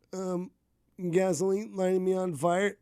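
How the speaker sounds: usual speaking voice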